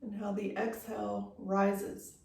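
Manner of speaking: unhurried yoga-teacher meditation voice, long pauses between phrases; guided meditation. Real, not acted. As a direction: guide them gently into stillness, leaving space after each phrase. Leads guided breathing meditation.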